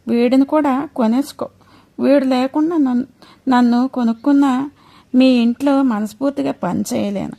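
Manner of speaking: high, pleading, near tears; high tearful plea of a mother; tearful begging mother. A narrator's reading. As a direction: beg the priest, voice climbing with grief. Mother begs, coaches her son.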